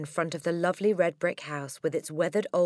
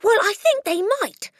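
high, loud excited cry; cried; 8 dB louder than usual. A narrator's reading. Matilda cries out to Miss Honey excitedly. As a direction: burst out hopefully. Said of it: "Matilda cried".

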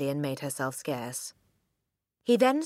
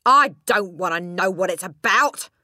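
loud, higher bark cutting her off; 4 semitones higher, 10 dB louder than usual. A narrator's reading. Mister Wormwood cutting Matilda off, loud barking. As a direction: bark her down mid-sentence. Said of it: "Mister Wormwood barked".

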